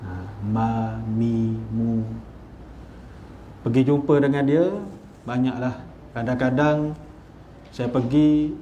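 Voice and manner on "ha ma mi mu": "ma, mi, mu" low and soft with open Arabic vowels; Arabic-style ma mi mu; imitating the sheikh's Arabic. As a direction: say "ma, mi, mu" as an Egyptian sheikh would.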